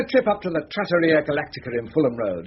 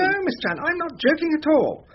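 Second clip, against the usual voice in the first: pitched much higher, pressing; earnest insistence; 11 semitones higher than usual. Acted. Head of chambers insists to young barrister, pitched high. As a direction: lean in and insist, all charm.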